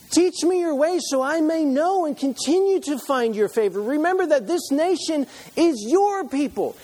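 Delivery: high, loud, urgent plea to God; pleading Moses. Acted.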